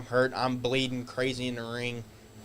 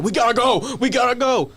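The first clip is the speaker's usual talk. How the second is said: high, loud, urgent; urgent shout; 11 semitones higher, 8 dB louder than usual. Retold or imitated. Security guard urging the wrestler out, loud and urgent. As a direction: yell it like security hauling you out. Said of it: "He was like".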